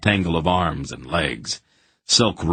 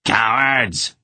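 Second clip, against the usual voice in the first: moderate angry shout of Cowards!; 5 semitones higher, 3 dB louder than usual. A narrator's reading. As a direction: yell Cowards! at the fleeing men, first time. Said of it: "he shouted at his hirelings".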